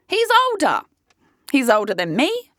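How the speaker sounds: high-pitched incredulous exclamation; exasperated. Real, not acted. Woman ranting to listeners about an older date, exasperated. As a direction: protest it high, then drop away.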